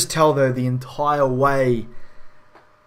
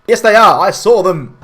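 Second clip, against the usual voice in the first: higher, much louder yell; mock shout; 6 semitones higher, 10 dB louder than usual. Gandalf yelling his warning at the villagers, shouting. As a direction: bellow it like an exasperated wizard.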